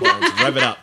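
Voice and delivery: high, squawky cartoon duck voice; Donald Duck voice. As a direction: quack the words out like Donald. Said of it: "show us your Donald".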